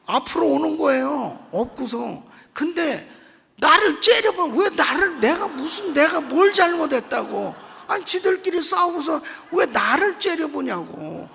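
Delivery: loud, high, worked-up telling; rising up in mock outrage at being blamed; indignant outburst. Real, not acted. Indignant retelling of being glared at. (억울해서 높고 크게 흥분한 말투)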